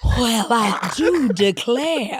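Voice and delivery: slow, hammed-up Southern belle drawl; a mock Gone with the Wind lady, drawn out slowly; Southern belle accent. Trying a Cajun accent, landing on a slow mock Southern belle drawl. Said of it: "That was Gone with the Wind".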